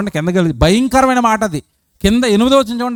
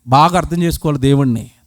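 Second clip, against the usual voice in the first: normal talking voice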